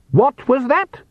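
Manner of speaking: high, sharp demand; sharp snap. A narrator's reading. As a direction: snap it out like an affronted lady. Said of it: "a female voice snapped".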